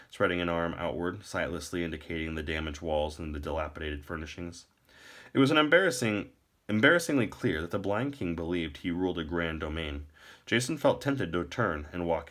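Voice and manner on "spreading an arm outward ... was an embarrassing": plain reading voice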